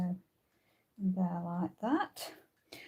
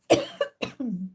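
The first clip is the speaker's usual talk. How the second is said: hard choking cough; coughing fit; 7 dB louder than usual. Real, not acted. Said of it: "seems that I'm choking now".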